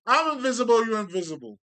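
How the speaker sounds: loud, high exclamation falling off; mock boasting shout. Speaker mimics everybody claiming to be invisible, loud mock boast. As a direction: call it out loudly, starting high.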